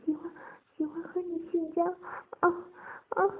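high, halting, shaky reply; trembling voice. A narrator's reading. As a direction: falter between small gasps. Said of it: "鹏飞颤声说道".

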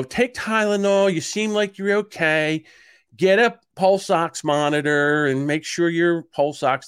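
high, slow, drawn-out phone advice; doctor's office staff. Retold or imitated. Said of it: "And they go".

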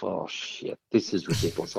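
a frightened private thought, half aloud; scared inner thought. Retold or imitated. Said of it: "thinking to myself".

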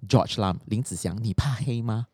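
usual speaking voice

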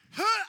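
high, loud sharp huh; a sharp startled huh; sudden gasp. Retold or imitated. Dad acting out the baby's reflex, sudden loud huh. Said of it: "and so she went".

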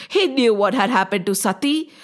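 usual speaking voice

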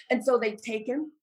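ordinary delivery

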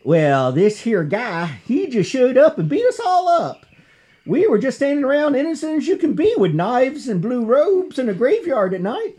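high, loud, falsely innocent Southern whine. Retold or imitated. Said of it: "they attempt saying at the sheriff".

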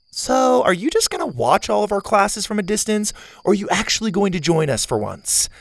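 a hint of a spunky girl; Zeta girl voice.

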